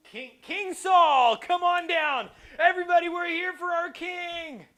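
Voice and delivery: loud, high, slow announcer call; MC calling king. Acted. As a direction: call him down like a game-show host.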